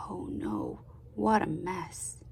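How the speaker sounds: breathy hushed dismay; whispered oh no. Acted. Reader to children at the mess, whispered. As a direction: whisper it like a worried secret.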